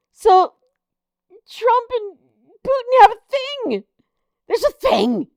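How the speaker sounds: high, sing-song teasing; a giddy, knowing singsong; gossipy glee. Real, not acted. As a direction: relish the juicy gossip. Gleeful gossip about Trump and Putin.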